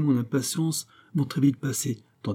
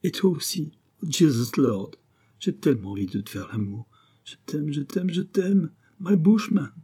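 passionate; 6 semitones higher than usual. Acted. Nathalie's passionate declaration.

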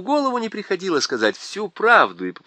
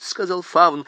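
plain reading voice

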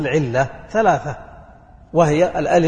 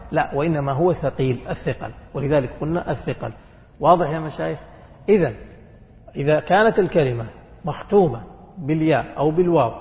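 ordinary delivery

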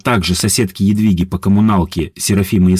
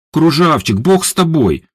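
snorting; 7 semitones higher than usual. A narrator's reading. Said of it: "фыркнула Едвига".

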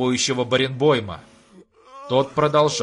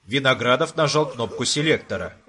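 ordinary delivery, nothing marked

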